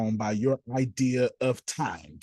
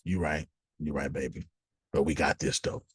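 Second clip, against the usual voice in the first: calm reassurance; 10 semitones lower, 5 dB quieter than usual. Retold or imitated. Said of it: "Kenneth's wife come to Kenneth".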